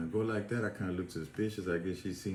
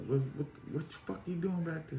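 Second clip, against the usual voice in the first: stammered, pitched-up interrogation; imitated guard's demand; 8 semitones higher than usual. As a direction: demand an answer as a worked-up guard. Retold guard demanding an answer.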